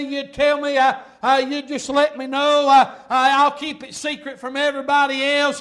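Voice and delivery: loud, preacher-style mock begging; pleading. Delilah nagging Samson for his secret, loud mock pleading. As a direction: plead insistently in a driving pulpit chant. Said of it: "whined around, begged, pleaded".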